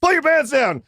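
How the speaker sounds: costumed burner orders them out; commanding burner. Retold or imitated. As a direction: bark the order at the scared kids. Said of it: "he goes".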